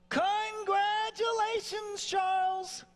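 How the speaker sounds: very high, drawn-out congratulations; party cheer. Retold or imitated. Inner voice celebrates after the phone call, party cheer. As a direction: sing out a celebration in falsetto. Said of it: "it's like a party going on".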